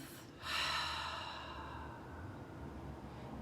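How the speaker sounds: long, soft audible out-breath; cleansing exhale. Shown as a demonstration. Guide shows listeners a cleansing breath, long exhale. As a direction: breathe out fully through the mouth.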